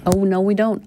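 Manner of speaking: normal talking voice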